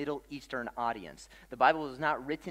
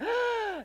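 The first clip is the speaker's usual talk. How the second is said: gasp; 5 dB louder than usual. Retold or imitated. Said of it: "they're going".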